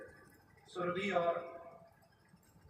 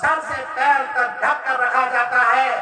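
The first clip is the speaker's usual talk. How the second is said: loud, high-pitched angry orders; domineering shout; 4 semitones higher, 7 dB louder than usual. Acted. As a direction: lay down the law at full volume. Husband orders wife about.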